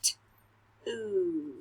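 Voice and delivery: T and oo said apart, slowly; sounding out words. Shown as a demonstration. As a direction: sound out each piece. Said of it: "Say them with me".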